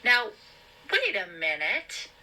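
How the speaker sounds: startled, high-pitched protest; high, alarmed objection; high-pitched cry. A narrator's reading. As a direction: cry out in rising fear and anger. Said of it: "surprised by her own high-pitched voice".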